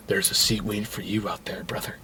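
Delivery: low, very soft preacherly voice; mock-mysterious quiet invitation; hushed solemn murmur. Joe invites the fugitive into church, hushed solemn. Quotes Joe speaking to runaway.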